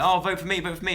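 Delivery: high-pitched mock plea for votes; lightly mocking, high campaign plea; pleading voters. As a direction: mimic eager people begging for a vote. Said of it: "people will then be like".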